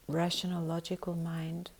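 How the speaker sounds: ordinary talk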